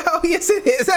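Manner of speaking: high, agreeable put-on voice; mocking client voice. Co-host imitating Twilla with clients, mocking. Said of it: "This is the Twiller when she's talking to the client".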